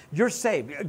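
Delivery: ordinary delivery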